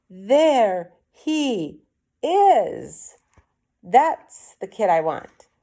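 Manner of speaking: put-on big man pointing out his chosen kid; excited discovery. A narrator's reading. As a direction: savor the find. Boss spots the kid, pointing.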